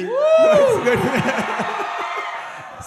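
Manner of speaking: squealing happy laugh; high excited laugh. Real, not acted. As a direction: burst into a high delighted laugh. Delighted at own punchline.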